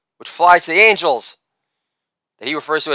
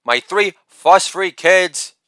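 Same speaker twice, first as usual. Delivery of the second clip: ordinary delivery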